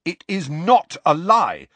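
loud, high, slow emphasis; angry denial. A narrator's reading. Student Harry contradicting the professor, angry. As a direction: contradict her hotly, hitting each word.

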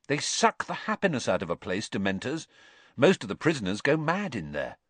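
put-on upbeat young lad voice; bright teenage boy telling a tale; lively teen boy. A narrator's reading. George voiced.

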